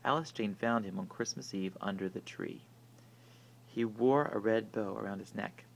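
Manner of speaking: ordinary delivery, nothing marked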